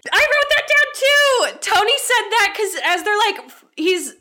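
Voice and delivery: loud, very high excited cry; excited outburst. Real, not acted. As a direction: burst in loudly, thrilled to match. Delighted both noted same line.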